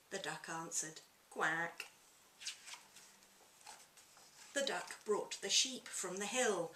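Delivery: lively picture-book reading aloud; story narration. A narrator's reading. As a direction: read it to children with relish.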